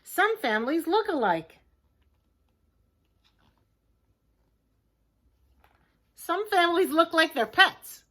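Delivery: excited. Acted. Excited picture book reading.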